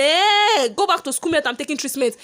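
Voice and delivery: high, loud sassy talk-back; loud retort. Retold or imitated. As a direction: push back at mum, outraged. Retorting to her mother.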